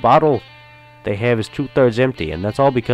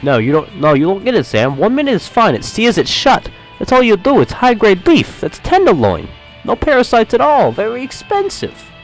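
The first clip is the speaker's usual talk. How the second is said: much higher and louder, indignant; insistent protest; 9 dB louder than usual. Acted. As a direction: defend the steak, getting worked up. John insisting, defending his steak.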